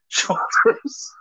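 high breathy laughing shout; triumphant laugh. Real, not acted. Triumphant laugh over saying word.

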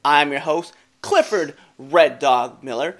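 high, loud show-host welcome; announcer welcome. Acted. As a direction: open the show like a big announcer. Hosts opening welcome.

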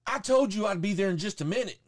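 imitating a worker's impatient holler; screaming drive-thru worker. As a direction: snap back like an irritated worker on a speaker. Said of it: "you don't scream at fucking people".

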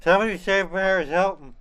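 loud, high mock cry for help; dramatic plea. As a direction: wail it like a desperate fan.

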